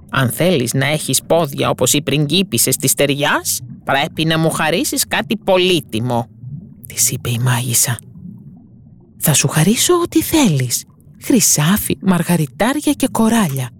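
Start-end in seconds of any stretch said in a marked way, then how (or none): 0.1-6.3 scheming witch voice setting her price; a sly cave witch, bargaining; sea witch. Acted. Witch tells the mermaid her price, sly. 9.2-13.7 put-on higher, eager young voice offering treasure; determined mermaid. Mermaid offers gold and pearls to the witch, determined. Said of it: "είπε αποφασισμένη".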